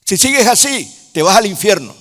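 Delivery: loud, high threatening judge; booming warning from God as judge; stern judge's threat. Pretend. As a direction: thunder it out as the angry judge. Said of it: "a que se asome el Señor, juez, y te diga".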